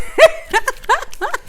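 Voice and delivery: high squealing giggle; high squealing laugh. Real, not acted. Laughing at her messy work.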